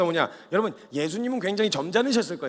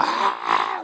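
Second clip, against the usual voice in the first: panicked yell; 3 dB louder than usual. Retold or imitated. Disciples panicking on boat.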